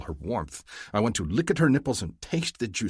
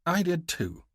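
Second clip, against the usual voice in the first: lightly raised female voice, slow and gentle; Mahdi's voice; 10 semitones higher than usual. A narrator's reading. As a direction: voice her higher and unhurried. Narrator voices female lover Mahdi.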